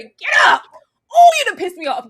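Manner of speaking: high, loud furious shout; explosive reenacted outrage; mad yelling. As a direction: yell at a fallen favorite. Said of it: "I was yelling".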